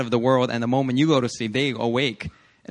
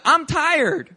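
put-on higher, louder whiny baby; mock baby whine, higher and louder; 12 semitones higher, 5 dB louder than usual. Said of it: "the baby don't know, like".

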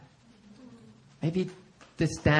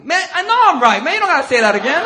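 high, loud exasperated retort; going off, shouting; 12 semitones higher, 10 dB louder than usual. Retold or imitated. Pastor brushing off Marcus's apology, loud scolding. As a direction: shout him down in mock outrage. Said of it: "I just go off on Marcus".

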